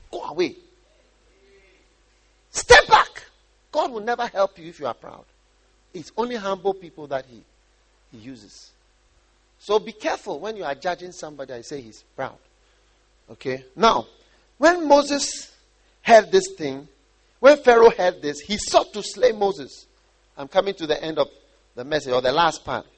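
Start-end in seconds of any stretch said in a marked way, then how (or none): 2.5-3.2 sudden high, loud order; shouted command. Acted. Preacher ordering the proud away, shouted command. 14.6-19.9 loud, emphatic Bible reading; scripture reading. Acted. Preacher reading how Pharaoh sought Moses, loud proclamation.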